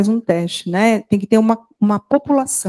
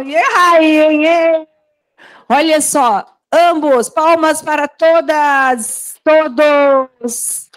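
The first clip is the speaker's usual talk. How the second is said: excited praise; 5 semitones higher, slower than usual. Real, not acted. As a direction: celebrate the students warmly. Praising the class for winning.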